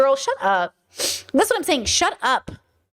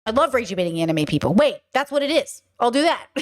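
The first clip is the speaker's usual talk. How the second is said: ordinary talk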